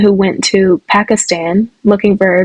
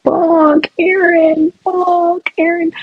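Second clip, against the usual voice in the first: high, breathy-free mock moan of the name, pitched way up; imitating Kayla; 9 semitones higher, much slower than usual.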